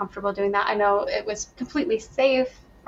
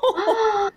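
gasp; 11 semitones higher than usual. Retold or imitated. Shocked at not in labor.

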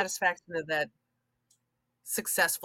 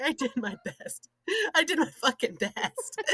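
mock sobbing; 9 semitones higher than usual. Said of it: "you're like".

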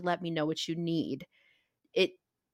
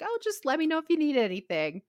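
lightly mocked, higher chirpy offer; mocking breezy offer; 8 semitones higher than usual. Mocking what people say to the sick, a lightly higher breezy offer. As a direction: toss it off like an empty polite offer. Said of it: "railed on this before when people just say".

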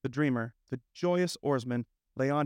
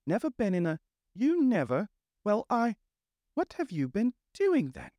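high, incredulous spluttering; open-mouthed astonishment; 8 semitones higher than usual. A narrator's reading. Rat questions the inexperienced Mole, high and incredulous. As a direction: sputter in broken phrases, pitched right up. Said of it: "open-mouthed".

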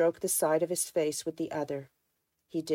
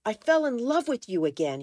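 raised, louder anguished outburst; exasperated confession, voice raised; angry cry; 7 semitones higher, 6 dB louder than usual. A narrator's reading. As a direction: cry it out, high and loud, in anger. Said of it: "he cried".

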